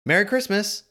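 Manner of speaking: a townsperson greets the Grinch with a merry shout; cheery Who greeting. Retold or imitated. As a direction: call out a merry greeting to a passerby. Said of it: "someone's like".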